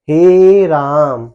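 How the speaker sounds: loud हे राम, both words held very long; forceful, drawn out. Shown as a demonstration. Teacher shows how hard people say it, हे राम loud and prolonged. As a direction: belt out हे राम with long stretched vowels. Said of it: "कितना कस के बोलते हैं".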